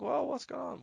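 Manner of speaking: sudden high, puzzled ask; puzzled question. Retold or imitated. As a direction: ask it like totally lost fans. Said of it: "people were like".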